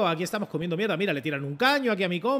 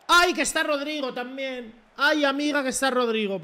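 high, drawn-out exclamation; alarmed wail; 7 semitones higher, 3 dB louder than usual. Real, not acted. Player spotting Rodrigo there too, alarmed wail. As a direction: wail as one more threat turns up.

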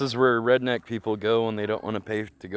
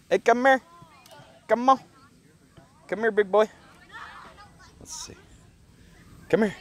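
high, coaxing call to a camel; calling an animal; 11 semitones higher than usual. Real, not acted. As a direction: sing it up high to lure the animal over.

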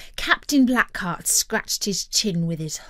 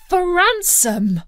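high, slow disbelieving cry; alarmed high-pitched outburst; startled cry; 10 semitones higher, 5 dB louder, slower than usual. A narrator's reading. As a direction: cry out as a shocked boy. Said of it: "cried Jack".

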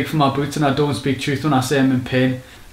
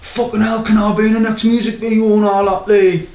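higher begging fan voice; hammed-up wannabe mate pleading; mock fan plea; 6 semitones higher than usual. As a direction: beg like a hanger-on wanting in the video. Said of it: "they're like".

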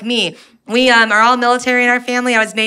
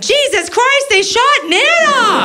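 much higher, shrieking in panic, slowing on the last words; high panicked yell at the funeral; panicked scream; much slower than usual. Retold or imitated. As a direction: scream it like you think Nana was shot. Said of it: "my brother screamed".

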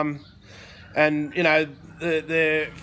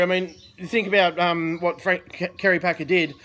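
usual speaking voice

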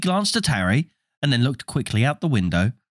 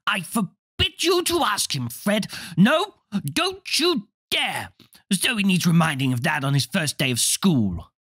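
high stern motherly warning, slowed; 9 semitones higher than usual. Acted. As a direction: scold the boy firmly from on high. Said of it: "Their mother suddenly became very stern".

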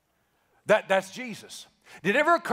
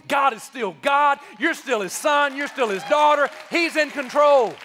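preaching that builds to higher, louder declarations; full-force preaching; 3 semitones higher, 3 dB louder than usual. Real, not acted. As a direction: drive each promise home to the congregation. Preaches to a climax.

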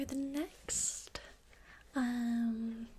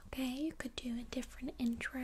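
ordinary talk, nothing marked

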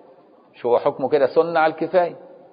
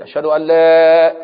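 raised call; 4 semitones higher, 7 dB louder, slower than usual. Shown as a demonstration. Teacher shows the raised second shahada of the call. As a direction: lift the voice on أشهد أن لا, much louder. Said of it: "ترفع بقى".

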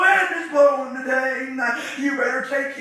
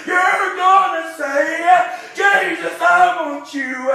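preaching; 3 semitones higher, 7 dB louder than usual. Acted. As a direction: preach it out to the congregation with rising fire. Preacher chanting to congregation.

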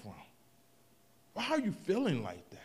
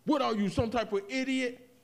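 higher, louder mock insult; a retold yell, raised and loud; imitated yell; 7 semitones higher, 6 dB louder than usual. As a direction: bark it like someone yelling at you. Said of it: "someone yells at me".

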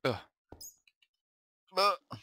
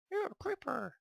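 much higher, playful teasing voice; mock squeaky taunt; slower than usual. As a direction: tease the creeper in a silly high voice. Teasing the game creeper.

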